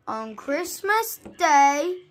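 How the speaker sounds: plain reading voice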